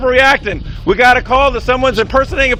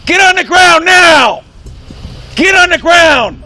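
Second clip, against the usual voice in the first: high, drawn-out police shout; shouted command; 4 semitones higher, slower than usual. Real, not acted. Officer ordering a man to the ground, shouted command.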